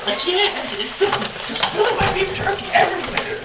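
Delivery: shrill, loud, panicky begging; high-pitched pleading. Acted. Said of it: "very high-pitched son of a bitch Hansen fan continues to plead".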